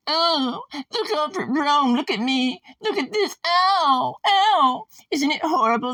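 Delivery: very high mock-crying squeak; mocking whiny victim. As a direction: whine theatrically for sympathy in a put-on voice. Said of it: "That's what the early Christians were like".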